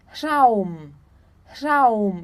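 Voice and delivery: "Raum" said slowly with AU as written; AU diphthong. Shown as a demonstration. Teacher showing A and U together, "Raum" with long AU. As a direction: draw out the AU in "Raum". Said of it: "eu tenho o som de au".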